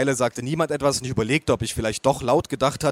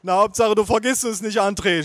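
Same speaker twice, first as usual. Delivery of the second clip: sobbing; 8 semitones higher, 3 dB louder than usual. Acted. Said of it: "schluchzte meine Oma".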